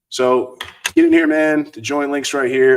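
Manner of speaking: pumped, come on in; loud urging. Real, not acted. Urging viewers to join.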